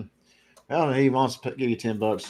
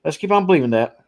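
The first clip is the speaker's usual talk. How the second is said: sarcastic; 4 semitones higher, 5 dB louder, faster than usual. Retold or imitated. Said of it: "I'm reading a little bit of sarcasm there".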